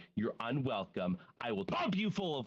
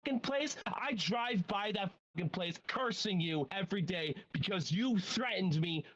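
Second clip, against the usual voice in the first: indignant rant; 9 semitones higher than usual. Real, not acted. Ranting at ex-manager.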